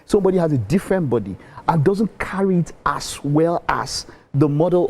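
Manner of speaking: ordinary talk, nothing marked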